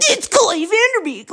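high-pitched blurted announcement; imitating loud brother. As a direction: blurt it out high, mimicking your little brother. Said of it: "in the loudest possible way".

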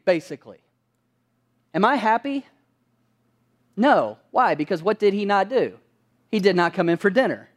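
ordinary talk, nothing marked

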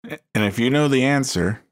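casual announcer. Pretend. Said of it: "It's my casual announcer persona".

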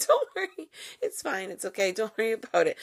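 high, breezy brush-off; mock reassurance. China brushing off the WHO, high mock reassurance. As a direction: wave it away like nothing's wrong. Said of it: "And China's like".

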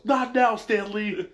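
high loud snap of a yell. Retold or imitated. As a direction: bark it out like an annoyed hero. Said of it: "Robin just yells".

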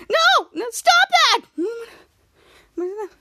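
high, loud mock-frantic yell; yelled protest. Captain Man and Frank in slap fight, yelled protest. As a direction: cry out to make it stop.

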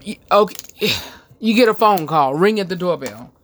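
ordinary talk, nothing marked

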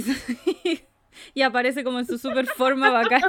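ordinary talk

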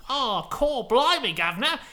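loud, high hammed-up Cockney; a silly mock Cockney "blimey, governor"; mock British accent. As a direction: overdo a cheeky British accent. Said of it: "Like that".

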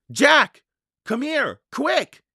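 high-pitched excited calling; high, sharp summons; urgent call. A narrator's reading. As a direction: call him over in a hurry. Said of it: "Annie called".

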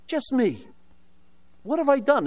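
retelling a whiny complaint to the nun, mock pleading; mock indignant plea. Retold complaint to Sister Loca.

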